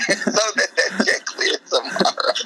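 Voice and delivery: high-pitched words squeezed through laughter; laughing. Real, not acted. Comedian finishing his robbery joke, real laughter. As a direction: crack up at your own punchline.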